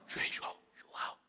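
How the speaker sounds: urgent unvoiced whisper; whispered call. Peter secretly calling John at dinner, whispered. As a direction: hiss his name across the table.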